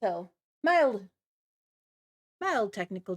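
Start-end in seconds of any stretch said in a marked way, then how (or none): none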